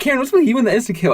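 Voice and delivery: quick high exasperated question; high fast voice. Retold or imitated. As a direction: pitch up, rushed. [name] quoting, high fast.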